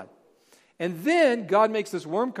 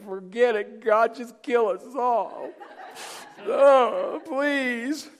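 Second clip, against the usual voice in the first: high, hammed-up plea; laughing mock despair; despairing parent; 8 semitones higher, much slower than usual. Father of six boys giving up on God, mock wail. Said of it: "am I just like".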